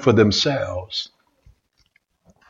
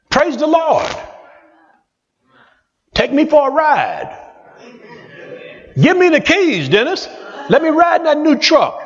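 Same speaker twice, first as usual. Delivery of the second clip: high, gushing mock praise; mock gushing churchgoer; 13 semitones higher than usual. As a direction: play the envious friend who fakes joy. Mock envious church members gushing.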